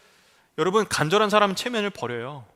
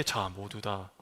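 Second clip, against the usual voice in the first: genteel; 11 semitones lower, 13 dB quieter, much slower than usual. Pretend. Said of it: "점잖게".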